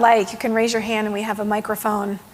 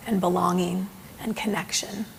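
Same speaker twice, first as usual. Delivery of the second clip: soft whisper; 4 semitones lower, 9 dB quieter than usual. Real, not acted. Softening close of the talk.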